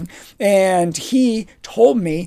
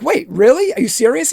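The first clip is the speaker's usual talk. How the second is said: much higher, hopeful "really"; mock thrilled surprise; 6 semitones higher than usual. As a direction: get your hopes up. Excited at Joe's fake news.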